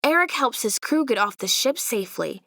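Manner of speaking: ordinary delivery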